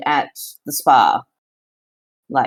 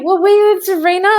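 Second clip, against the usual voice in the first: high, startled question; startled realisation; 13 semitones higher, 4 dB louder than usual. Real, not acted. Player realising she forgot who was there, startled. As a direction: voice leaps up in real surprise.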